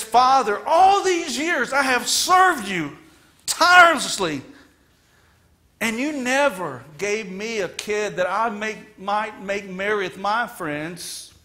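high, loud bitter complaint; resentful son. Acted. Older son complaining to father, loud and bitter. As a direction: throw years of service in his face. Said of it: "he's so selfish".

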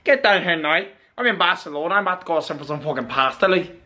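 jokey Scouse-Irish lilt; hammed-up Belfast-Dublin-Liverpool mash; Liverpool or Irish accent. Shown as a demonstration. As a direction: break into a mock Liverpool accent. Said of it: "a Liverpool accent or a Dublin accent or Belfast".

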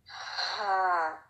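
a soft, airy exhaled ha; breathy ha. Shown as a demonstration. Teacher shows the breath exercise: soft airy ha. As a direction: let the breath out as ha. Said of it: "ये जो sound है ना".

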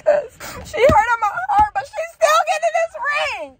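high, wailing imitation of a hurt boyfriend; mock heartbroken whine. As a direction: wail it high, hammed-up. Acting out hurt boyfriend venting.

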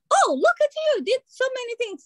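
impression of the coach praising. Coach praising her client, mock high-pitched praise. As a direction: coo approval at the client. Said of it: "And you are so calm".

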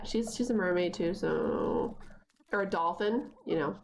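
normal talking voice

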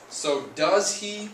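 slow, emphatic "does" to show the verb; stress on does. Shown as a demonstration. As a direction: push the word "does" up and out. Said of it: "teacher stressing does".

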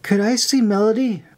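put-on high-pitched young character; timid request. A narrator's reading. Child asks in voiced dialogue.

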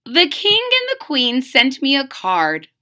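ordinary delivery, nothing marked

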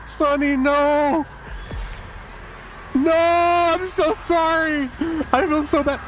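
high, pleading sorry; real remorse, stretched slow on so sorry; anguished apology. Real, not acted. Apologising to killed teammate.

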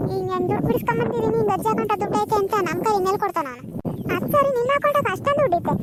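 high-pitched village aunt, complaining. Acted. Aunt to grandma, arguing about the money in a high complaining voice. Plays aunt figure in skit.

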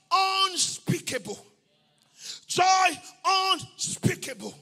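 slow, stretched proclamation of key phrases; rapturous pulpit declaration; full-force preaching. Acted. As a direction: draw out each word with fervour.

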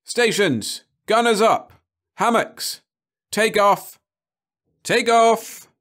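loud, high alarm shout; loud launch-stations shout; shouted orders. A narrator's reading. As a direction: bark orders across the ship. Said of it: "a voice shouted".

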